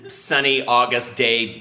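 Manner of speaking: ordinary talk, nothing marked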